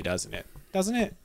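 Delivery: ordinary delivery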